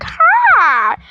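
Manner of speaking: very high "ha" sliding down; imitated high-pitched yelp; mock startled cry. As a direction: let out a shrill comic "haaa".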